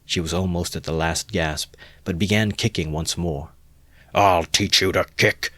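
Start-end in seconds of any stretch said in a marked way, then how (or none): 4.1-5.5 louder, savage threat; ferocious shout. A narrator's reading. Drunk owner threatening his mare, ferocious shout. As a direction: bark it at the mare. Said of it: "Mikolka shouted ferociously".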